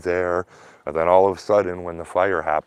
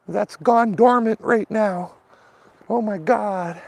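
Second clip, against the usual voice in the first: much higher, put-on fussy neighbor voice; high mock whine of a fussy neighbor; mocking whiny neighbor. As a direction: squeal it like a fussy homeowner.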